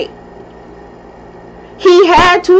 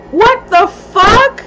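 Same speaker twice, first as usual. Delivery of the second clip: slow disbelief; 7 semitones higher, slower than usual. Retold or imitated. Retold confrontation, floored.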